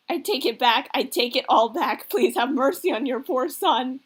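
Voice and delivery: loud, melodramatic begging; acted desperate pleading. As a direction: plead loudly for mercy, hammed-up. Son begs dad to stop.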